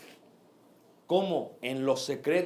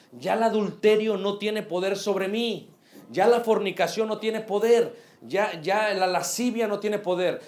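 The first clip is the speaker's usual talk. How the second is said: louder, driving sermon delivery; full-force preacher calling out to the brothers; fiery preaching; 3 semitones higher, 4 dB louder than usual. Acted. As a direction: preach it hard to the congregation.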